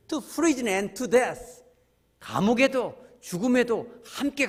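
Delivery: drawn out, each word weighed; slow solemn vow. Acted. Preacher quoting Peter's vow to Jesus, slow and solemn. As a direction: quote the vow slowly and gravely.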